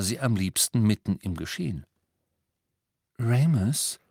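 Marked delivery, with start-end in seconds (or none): 3.2-4.0 tentative, slightly higher call of a name; timid. A narrator's reading. Hermine carefully addressing Remus about his marriage, timid. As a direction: gently test the water, timidly. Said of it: "sagte Hermine zaghaft".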